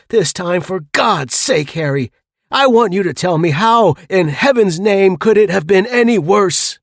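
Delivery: loud, high exasperated demand; angry demand. Acted. Harry shaking James for an answer, angry. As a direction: insist furiously for an answer. Grabs him by the shoulders.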